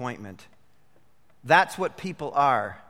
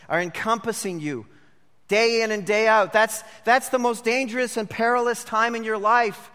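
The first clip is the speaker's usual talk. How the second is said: rising, louder preaching build; 6 semitones higher, 4 dB louder than usual. Acted. Preacher urging the congregation never to give up, rising louder. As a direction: press the congregation hard, louder toward the end.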